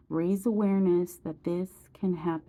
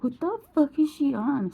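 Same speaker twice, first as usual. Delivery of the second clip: mocking onlookers' reaction; 7 semitones higher than usual. Imitating people who judge her.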